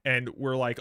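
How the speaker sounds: ordinary delivery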